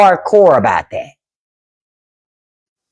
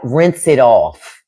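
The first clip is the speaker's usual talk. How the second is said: lower, slow, plain-spoken mom; retold mom's slow correction; translating mom; 5 semitones lower, much slower than usual. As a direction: say it slowly and clearly as mom explaining. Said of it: "my mom was like".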